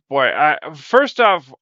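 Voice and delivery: ordinary talk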